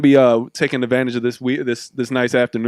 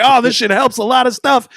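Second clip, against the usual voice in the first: higher, pleased-discovery voice; mock naive enthusiasm; 9 semitones higher, 4 dB louder than usual. Host mimics early coal burners, mock enthusiasm. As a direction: act out an oblivious eureka moment.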